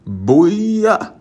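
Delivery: high, loud triumphant shout; booyah shout. Acted. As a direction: shout it like a victory cheer. Triumphant exclamation after aside.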